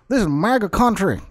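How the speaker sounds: mock a Trump fan, hard ending dropped. Shown as a demonstration. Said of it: "they drop the hard end".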